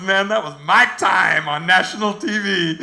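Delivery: acted proud delight, laughter close by; retold gleeful triumph. Contestant tells Brad of her TV moment, acted gleeful triumph. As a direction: crow it like an elated loser. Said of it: "All you hear is this hysterical cackling".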